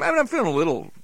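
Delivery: ordinary talk, nothing marked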